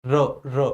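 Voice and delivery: र र rolled on the tongue; trilled r demo. Shown as a demonstration. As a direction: let the tongue vibrate on र, र. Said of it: "जिह्वा पर झंझनाहट हुई".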